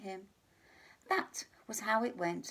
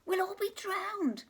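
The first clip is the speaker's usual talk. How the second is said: higher squeaky mouse cry; squeaking mouse; 12 semitones higher, 3 dB louder than usual. Acted. Mouse squeaking panic to the others. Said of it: "squeaked the mouse".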